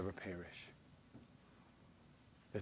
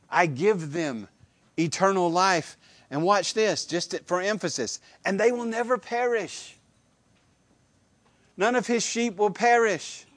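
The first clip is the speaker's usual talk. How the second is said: higher and louder, hammering each phrase; preaching at full force; 8 semitones higher, 7 dB louder than usual. Acted. Preacher proclaiming the sheep never perish, full-force preaching. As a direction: lift the congregation with conviction.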